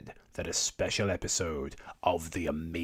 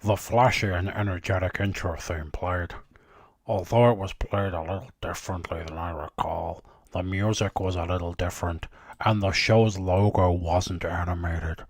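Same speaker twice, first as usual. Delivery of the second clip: Doctor voice; 5 dB louder than usual. Acted. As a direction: read it like the Doctor, booming. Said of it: "I'm gonna have to talk in that voice now".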